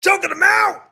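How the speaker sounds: high, loud excited yell; excited shout. Real, not acted. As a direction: yell it at the screen. Excited wrestling commentary.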